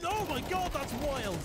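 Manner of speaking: ordinary delivery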